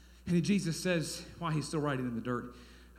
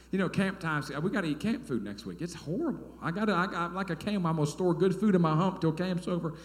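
ordinary delivery

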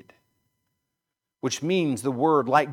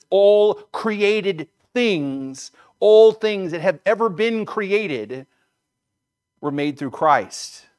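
slow, loud and higher, weight on "all"; emphatic declaration; 6 semitones higher, 7 dB louder, much slower than usual. Retold or imitated. Preacher driving home John's claim about creation, emphatic. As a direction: drive home "all created things", stretched out and raised. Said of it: "John emphatically declares that".

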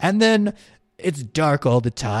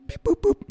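button beeps; 4 dB quieter than usual. Acted. Pressing buttons on the phone.

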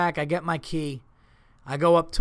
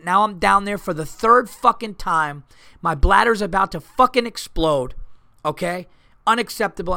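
higher, louder exasperated rant; building rant; 5 semitones higher, 7 dB louder than usual. Real, not acted. Comic ranting to the crowd about broken keys, furious. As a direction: wind up into full outrage.

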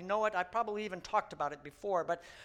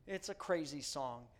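ordinary talk, nothing marked

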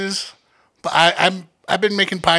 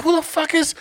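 much higher-pitched incredulous question; baffled bystander; 12 semitones higher than usual. Retold or imitated. Friend indoors overhearing Julie fighting outside, baffled. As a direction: ask it high, in disbelief.

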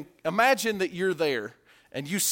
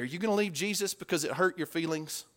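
ordinary talk

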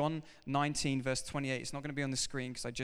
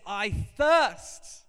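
I thirst cried out loud and high, stressing thirst; loud cry; 6 semitones higher, 9 dB louder than usual. Acted. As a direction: cry it out like a parched man. Jesus's words, climax of point.

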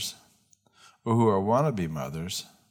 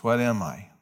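ordinary talk, nothing marked

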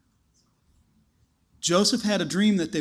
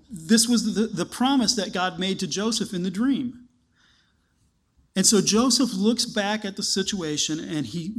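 normal talking voice

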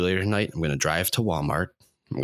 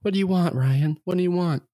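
eager charity worker; 13 semitones higher than usual. Retold or imitated. Charity worker asking the dying man his wish, high and eager. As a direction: pitch it up like an overeager helper. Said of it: "Make-A-Wish is gonna come".

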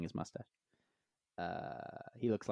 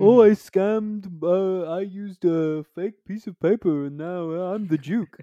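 much higher, louder, slow pompous braggart; mock boastful con man; 8 semitones higher, 11 dB louder than usual. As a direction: ham up a smug fake duke.

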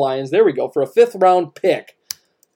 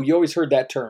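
normal talking voice

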